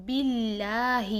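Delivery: باللہ with a thin, light bi, slow; باللہ, bi not be; thin kasra. Shown as a demonstration. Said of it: "با کی زیر کو ہم باریک رکھیں گے".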